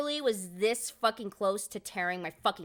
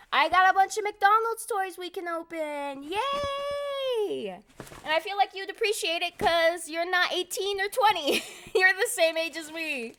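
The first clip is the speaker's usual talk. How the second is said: high, bright-eyed toy excitement; excited cheer; 10 semitones higher, 6 dB louder, slower than usual. Real, not acted. Telling chat about McDonald's toys, excited. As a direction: pump up the viewers about the toys.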